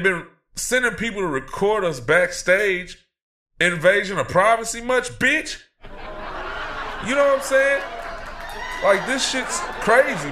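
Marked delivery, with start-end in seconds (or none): 5.2-10.3 laughing outrage. Acted. Host mocks network, laughing.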